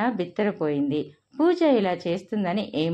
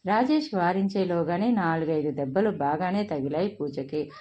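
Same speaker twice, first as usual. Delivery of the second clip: usual speaking voice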